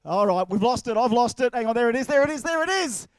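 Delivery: tracking the ball with rising excitement; excited. Real, not acted.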